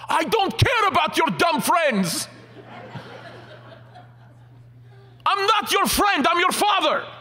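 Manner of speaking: high, loud, slow shouted rebuke; furious father. Retold or imitated. Father shouts at his son that he is not his friend, furious. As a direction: bellow it down at the kid.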